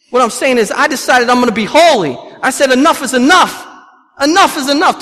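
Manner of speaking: loud, high-pitched pulpit declaration; forceful preaching. Real, not acted. Preacher recalling his vow to be holy, forceful preaching.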